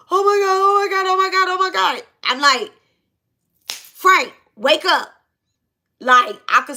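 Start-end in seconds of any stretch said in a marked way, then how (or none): 0.1-2.0 high, breathless-run panic on one pitch; panicked. Retold or imitated. Retelling kid panicking at gunpoint. 3.7-5.1 higher, louder retold shout; urgent calling out.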